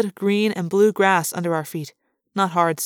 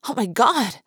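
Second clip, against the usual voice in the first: higher-pitched gasp of amazement; astonished gasp; 5 semitones higher than usual. A narrator's reading. Lauren sees the planet through the window, gasping. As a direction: gasp it out in awe. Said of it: "Lauren gasped".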